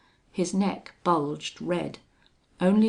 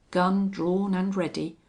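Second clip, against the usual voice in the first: plain reading voice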